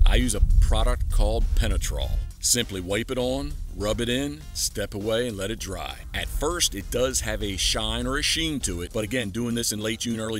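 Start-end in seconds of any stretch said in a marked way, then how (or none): none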